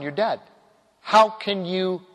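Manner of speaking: usual speaking voice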